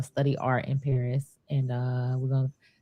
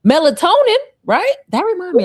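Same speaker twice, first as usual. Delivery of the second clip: excited; 8 dB louder than usual. Real, not acted. Sudden idea mid conversation.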